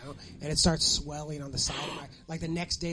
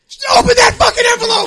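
very high, loud, gleeful shout; hammed-up excited yell; yelled demand; 18 dB louder than usual. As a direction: order it at the top of your voice. Acted triumphant demand at the register.

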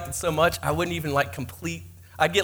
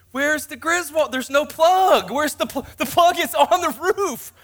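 frantic disbelief; 9 semitones higher than usual. Retold or imitated. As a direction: act out the panic on the roof. Frantic search for the plug.

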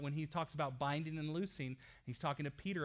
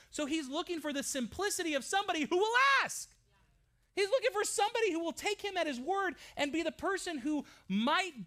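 fiery preaching; 10 semitones higher, 5 dB louder than usual. Acted. Pastor preaching to the church, higher and louder. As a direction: preach it out to the congregation.

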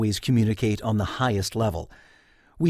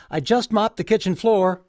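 raised, higher call from upstairs, stressing just; wife calling down; 10 semitones higher, 4 dB louder than usual. Retold or imitated. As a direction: call it down the stairs to her husband. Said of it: "called down".

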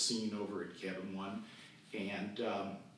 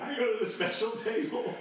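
put-on even higher, pushier kid's plea; more eager begging; 13 semitones higher, 6 dB louder than usual. Camper pestering his counselor again, higher excited plea. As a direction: push the plea up a notch.

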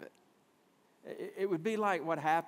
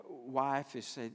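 ordinary delivery

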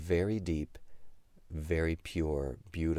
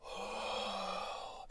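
breathy high whispered ooh; 9 dB quieter than usual. Shown as a demonstration. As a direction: blow a loud whispered ooh. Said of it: "Can you do a loud whisper ooh?".

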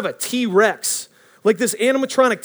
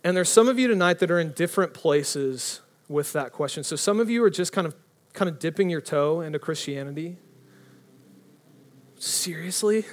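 normal talking voice

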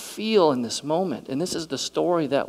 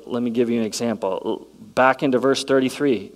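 normal talking voice

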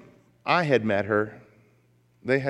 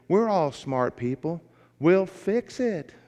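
put-on Southern accent; 7 semitones higher, slower than usual. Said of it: "with a very charming Southern accent".

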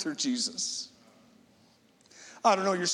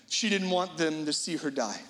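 ordinary talk, nothing marked

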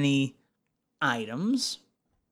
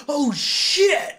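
shocked onlooker; 12 semitones higher, 4 dB louder than usual. Pretend. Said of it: "they go".

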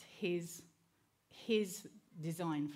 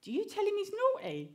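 much higher, louder, faster quizzing voice; nursery woman; 7 dB louder, faster than usual. Retold or imitated. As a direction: play the pointed nursery teacher asking. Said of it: "the nursery woman goes to me".

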